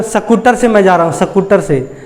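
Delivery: स्कूटर said as सकूटर, a full a sounded after the s; Haryana-style mispronunciation. Shown as a demonstration. Haryanvi speaker saying he came by scooter, pronounced सकूटर. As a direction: break स्कूटर into स-कूटर with a full vowel after स. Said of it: "स के बाद जो है उसको पूरा उच्चारण करता है".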